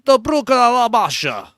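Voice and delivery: hammed-up fake Russian accent; fake Russian gibberish. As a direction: spout made-up Russian-sounding words. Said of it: "speak Russian gibberish".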